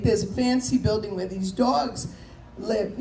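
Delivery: ordinary delivery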